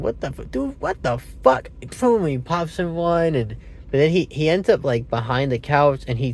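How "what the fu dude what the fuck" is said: shocked. Retold or imitated. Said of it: "The dude said".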